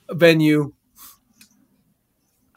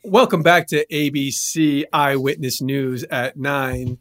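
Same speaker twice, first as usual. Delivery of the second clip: lower, measured anchor voice; news anchor read; 5 semitones lower than usual. Acted. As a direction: read it like the nine o'clock news.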